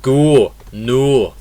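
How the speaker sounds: "go, no" with a pure, rounded Scottish O, no glide. Shown as a demonstration. Teacher contrasting with British English, Scottish O in "go, no". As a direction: round the vowel of "go, no" like a Scot. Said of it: "In Scottish English it will be".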